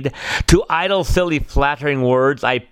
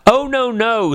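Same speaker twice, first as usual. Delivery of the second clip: high, timid little fly; 10 semitones higher than usual. Acted. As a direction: squeak out a nervous refusal. Fly refusing again.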